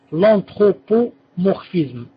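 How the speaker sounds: slow, every syllable spelled out for note-takers; stretched-out dictation of L'anthropomorphisme. Shown as a demonstration. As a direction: dictate it slowly so they can write. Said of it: "Est-ce que quelqu'un peut me les noter".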